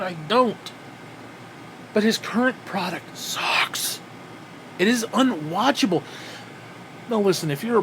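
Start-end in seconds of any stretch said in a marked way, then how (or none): 1.9-6.0 slow whispered verdict. Real, not acted. Telling viewers about Vince's show, slow whisper. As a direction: hush it like a confession. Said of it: "whispered aside".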